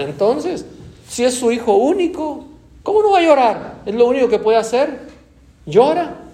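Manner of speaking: high, loud, pleading argument to the Lord; loud protest. Acted. Preacher arguing for the widow, loud high protest. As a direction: argue back, voice straining upward. Preacher voices human objection to Jesus.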